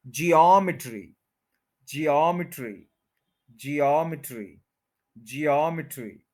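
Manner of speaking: Geometry as jee-AWM-i-tree, falling; measured jee-AWM-i-tree for geometry; second-syllable stress. Shown as a demonstration. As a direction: lengthen AWM in geometry, short "i". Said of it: "second पे stress है".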